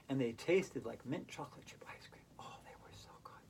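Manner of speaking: soft whisper of delight; whispered savoring of the cookies; dreamy whisper. Real, not acted. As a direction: whisper it like a treat.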